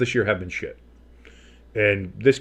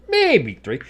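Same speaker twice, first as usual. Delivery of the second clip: high, louder, drawn-out MAYBE; hammed-up repeat; 9 semitones higher, 5 dB louder than usual. Streamer joking about the yardage gained, hammed-up high MAYBE. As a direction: stretch MAYBE way up high, then drop.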